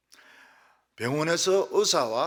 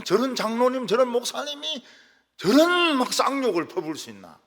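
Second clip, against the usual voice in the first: mock disbelief, pitched up and loud; shocked onlookers; 9 semitones higher, 7 dB louder than usual. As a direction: imitate aghast churchgoers, higher and louder. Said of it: "깜짝 놀라죠, 사람들이".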